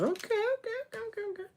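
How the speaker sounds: very high, squeaky, soft okays. Real, not acted. Streamer squeaking at a confusing game, very high and soft.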